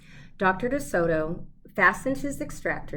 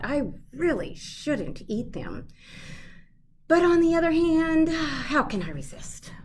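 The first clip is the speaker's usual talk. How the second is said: 1 tempted inner voice; 9 semitones higher, 4 dB louder than usual. A narrator's reading. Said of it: "he thought".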